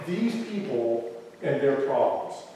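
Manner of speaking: normal talking voice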